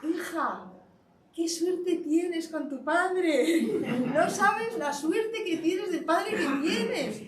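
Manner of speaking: slow, high, laughing through the words; retelling her words to the girl, laughing and slow; laughing praise. Retold or imitated. To a crying girl about her father, slow and laughing. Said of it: "yo me acerqué y le dije".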